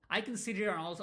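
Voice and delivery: normal talking voice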